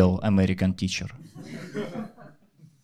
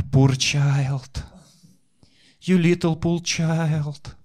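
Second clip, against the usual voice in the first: put-on pitying old lady; 2 semitones higher than usual. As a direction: lightly voice a fussing old granny.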